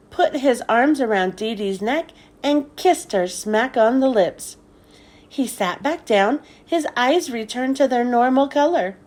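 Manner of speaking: plain reading voice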